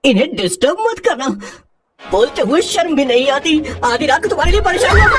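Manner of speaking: high-pitched indignant telling-off; scolding. Acted. Scolding someone late at night. (ऊँची आवाज़ में डाँटते हुए)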